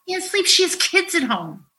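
high, upset voice; emotional. Real, not acted. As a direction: pitch climbing with real distress. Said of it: "I'm gonna get emotional here".

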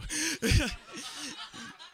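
high-pitched flustered giggle; nervous giggle. Acted. Boy giggles after kissing his crush, high and flustered.

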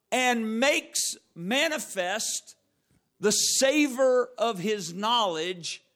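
slow, drawn-out scripture reading; slow preaching. Shown as a demonstration. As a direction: stretch every word of the verse. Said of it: "I'm really trying to go slow".

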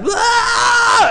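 high, loud imitated infant shriek with a laugh; hammed-up baby scream; mock baby scream. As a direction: wail like a jabbed baby, half laughing. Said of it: "they just start".

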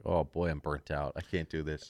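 low, quiet, deflated giving up; acted-out weary surrender; mock burnout. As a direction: drop the voice and fade out, defeated. Acting out burnout after starting.

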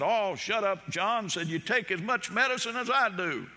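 old wife snapping at her husband, lightly voiced; hollering wife. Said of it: "She hollered".

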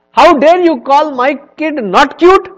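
loud, high outraged shout; angry king. Retold or imitated. Akbar shouting at Birbal in outrage. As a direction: roar it as an insulted emperor. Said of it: "Akbar got so mad at him".

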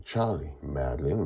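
ordinary delivery, nothing marked

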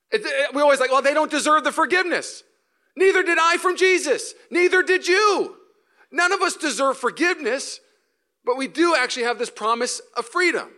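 high, loud preacher's cry; shouting the point about forgiveness; full-force preaching. Acted. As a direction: lift the congregation with it. Preaching climax, much higher and louder.

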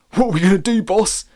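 high, loud henchman voice; eager henchman. Acted. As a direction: ask the boss, high and loud. Broccoli henchman asks boss.

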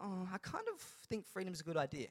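soft, wishy-washy imitation; hushed, noncommittal mock musing; mock half-hearted. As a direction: pretend to be only mildly convinced. Mimics an imagined uncommitted response.

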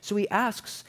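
plain reading voice

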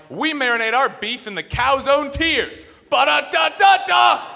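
high, loud, slow jingle shout; mock commercial. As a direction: belt it like a cheesy fast food ad. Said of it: "McDonald's come out with a commercial".

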